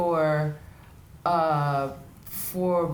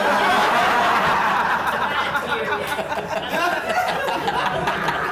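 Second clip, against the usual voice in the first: long laugh; 4 semitones higher, 4 dB louder than usual. Real, not acted. Laughing at own joke.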